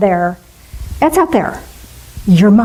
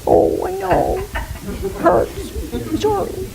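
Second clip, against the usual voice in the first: high, soft, hushed consoling coo; soothing comfort; 9 semitones higher, 6 dB quieter than usual. Retold or imitated. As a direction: softly comfort a crying child. God voiced comforting a tantrum.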